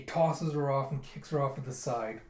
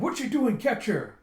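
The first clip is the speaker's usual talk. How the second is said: high, loud panicked order; alarmed shout; 9 semitones higher, 7 dB louder than usual. Retold or imitated. Guard ordering others to catch Yona, alarmed shout. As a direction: yell orders in mock alarm. Guard yells to stop her.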